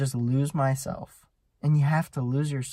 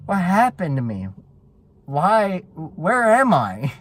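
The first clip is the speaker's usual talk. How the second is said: loud, higher-pitched baffled questioning; acted bewilderment; 4 semitones higher, 6 dB louder, slower than usual. Rider snapping out of the zone, loudly bewildered. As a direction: act dazed and baffled, questions pushed up loud.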